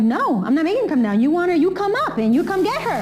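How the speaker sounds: high-pitched, heated retort, rising as it goes; defiant refusal. Retold or imitated. Retelling her refusal to send Liza down, defiant and high. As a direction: act out standing your ground, voice climbing.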